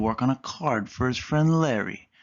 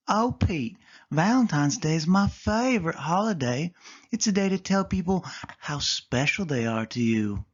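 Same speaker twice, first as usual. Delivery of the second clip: put-on higher girl cat voice, pressing her point; insisting Callie; 5 semitones higher than usual. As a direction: gently insist it's the best holiday. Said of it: "Callie insisted".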